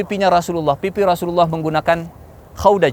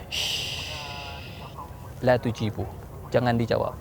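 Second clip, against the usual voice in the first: soft, low warning after a shush; hushing; 4 semitones lower, 11 dB quieter, slower than usual. Acted. Prophet to companions, hushed order not to answer. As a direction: hush them so nobody answers.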